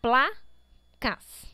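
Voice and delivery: placas as two pieces, pla-cas, with a gap; syllable by syllable. Shown as a demonstration. Teacher checks the division for pupils, syllable by syllable. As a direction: push out pla, pause, then cas. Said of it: "todas as palavras estão separadas".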